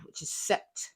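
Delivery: "sept" said softly as French "set"; quiet French "sept". Shown as a demonstration. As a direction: give "sept" its French sound, one syllable. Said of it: "the French word for seven".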